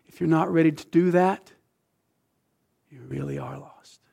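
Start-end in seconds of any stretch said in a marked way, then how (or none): 2.9-4.0 hushed warning. Real, not acted. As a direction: drop almost to a hush to sober them. Preacher drops to grave warning.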